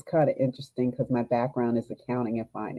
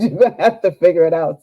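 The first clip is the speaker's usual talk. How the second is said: higher and louder, laughing through the doctor's words; laughing repeat; 4 semitones higher, 10 dB louder than usual. Retold or imitated. Repeating the doctor's answer to the coach, laughing hard. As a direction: retell it again, cracking up. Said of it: "He said".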